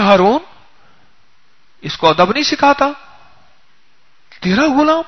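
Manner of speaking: loud, rising outraged question; indignant question. Retold or imitated. Offended guest scolding Harun about his rude servant, loud and indignant. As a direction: demand an answer, scandalised and loud.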